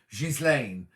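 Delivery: Ghislaine opening with a clear Giz; sounded Giz. Shown as a demonstration. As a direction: say Ghislaine slowly as "Jiz-lane". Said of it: "to pronounce the Giz".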